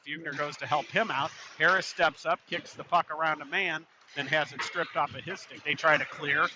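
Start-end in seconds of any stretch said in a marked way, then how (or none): none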